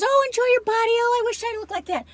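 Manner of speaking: high, gushing mock voice; mock gushing admirer. Mimicking people who praise her looks, high and gushing. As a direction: trill it like an over-the-top fan. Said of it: "Everyone says".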